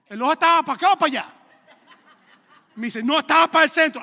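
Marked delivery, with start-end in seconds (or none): 0.1-1.3 muy fuerte y rápido, insistiendo; pregunta exagerada. Retold or imitated. 3.0-4.0 alto y fuerte, remate de chiste; respuesta cómica. Retold or imitated. Responde el hermano, cómico.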